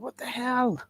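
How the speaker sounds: retelling his pained outrage at the dentist, high and squeaky; shocked squeal. Retold or imitated. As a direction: squeal it like a patient in pain. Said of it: "and I was like".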